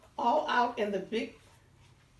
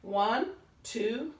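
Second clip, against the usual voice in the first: plain reading voice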